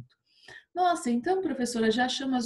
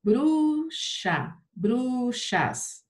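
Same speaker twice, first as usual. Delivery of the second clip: "Bruxa, bruxas" in drawn-out, separated syllables; 4 semitones higher, much slower than usual. Shown as a demonstration. Teacher showing pupils where bruxa's accent falls, sounded out by syllable. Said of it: "ó".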